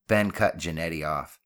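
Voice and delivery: plain reading voice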